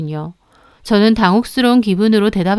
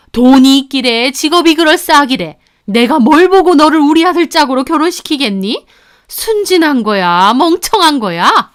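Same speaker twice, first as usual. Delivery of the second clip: sneering mother; 4 semitones higher than usual. Retold or imitated. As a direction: look down on her openly.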